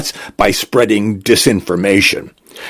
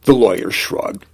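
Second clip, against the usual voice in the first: ordinary delivery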